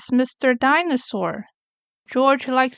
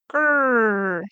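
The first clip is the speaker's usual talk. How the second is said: rolled toy-dinosaur grrr; toy dinosaur growl. Acted. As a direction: growl like a little toy monster.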